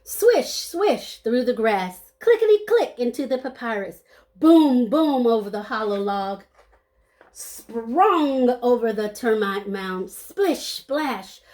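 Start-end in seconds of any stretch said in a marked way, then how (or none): none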